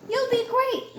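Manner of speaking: high, bright reassurance; cheerful encouragement. Acted. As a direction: cheer her friend on. Meg encouraging Jill.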